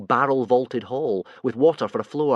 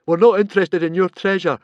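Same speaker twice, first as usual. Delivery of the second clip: coldly; 7 semitones higher, 7 dB louder than usual. A narrator's reading. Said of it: "said Fishlegs coldly".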